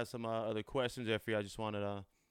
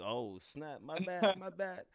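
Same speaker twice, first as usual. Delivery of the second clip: mock apology; 8 semitones higher, slower than usual. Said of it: "I'm like".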